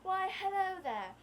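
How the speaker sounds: high, slow butler greeting; hammed-up British butler hello. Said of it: "butler suit and top hat".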